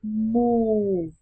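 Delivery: "Move" with the oo stretched out; long U drill. Shown as a demonstration. Teacher modelling a practice word, "Move" long and clear. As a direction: draw out the vowel of "Move", falling. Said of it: "luyện tập nói các từ sau".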